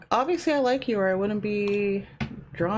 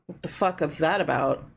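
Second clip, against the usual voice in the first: ordinary talk, nothing marked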